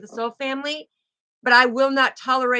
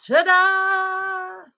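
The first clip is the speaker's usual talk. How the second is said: high, long-held singsong ta-da; bright-morning fanfare, held on the last note; showy ta-da; 8 semitones higher, 3 dB louder than usual. Pretend. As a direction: announce a grand reveal, pitched high and drawn out. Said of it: "And I'll be like".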